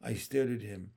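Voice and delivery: ordinary delivery, nothing marked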